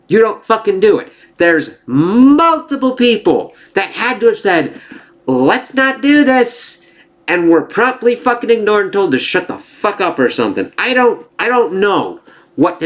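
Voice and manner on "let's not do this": mock staffer pleading. Imitating cautious staff members.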